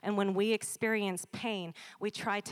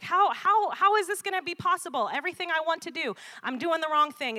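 high, louder, exasperated Paul; frustrated Paul; 8 semitones higher, 6 dB louder than usual. Retold or imitated. Preacher voicing Paul in Romans seven, high and exasperated. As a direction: cry out like a man at war with himself. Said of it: "he starts to say, like".

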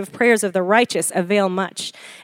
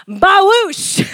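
splash sound effect; 8 semitones higher than usual. Shown as a demonstration. Preacher miming a beach ball bursting out of the water. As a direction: make the beach ball explode out. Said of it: "beach ball bursting up".

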